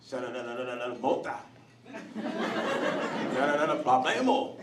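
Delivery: imitated rapid Spanish babble; mock Spanish chatter. Overhearing Jose and police talk, mock Spanish gibberish. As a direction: gibberish Spanish with the odd real word. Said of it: "all you hear is".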